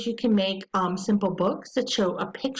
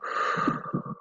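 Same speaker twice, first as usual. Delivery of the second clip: put-on audible deep breath for the child; a slow demonstration breath; deep breath demo; 4 dB quieter than usual. Shown as a demonstration. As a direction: breathe out slowly. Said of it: "We'll breathe in through our nose".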